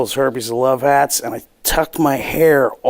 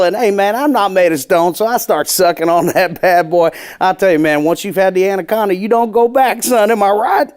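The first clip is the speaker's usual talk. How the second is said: put-on hacky Southern accent; 5 semitones higher than usual. Comedian on stage baiting the crowd, Southern put-on voice. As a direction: keep selling the Southern act into the punchline. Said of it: "hackiest Southern bullshit".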